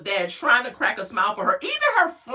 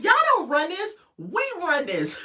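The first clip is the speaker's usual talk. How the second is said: bossy producers; 7 semitones higher than usual. Retold or imitated. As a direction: lay down the law like a cocky producer. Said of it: "The producers was like".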